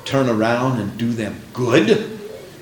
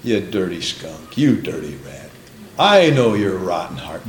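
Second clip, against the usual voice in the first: mock insult; 8 semitones lower than usual. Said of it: "you know what Jesus called him?".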